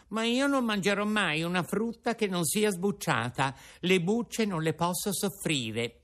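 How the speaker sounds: put-on high, fast boyish puppet voice; a hungry puppet pouring out his story; puppet Pinocchio. Pinocchio telling Geppetto his hunger woes, high puppet voice.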